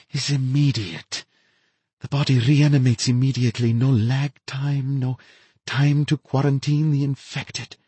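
gleeful mad scientist boasting; ecstatic mad scientist. A narrator's reading. Narrator voices the infected woman.

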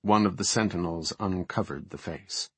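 ordinary delivery, nothing marked

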